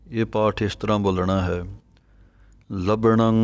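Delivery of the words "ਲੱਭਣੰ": usual speaking voice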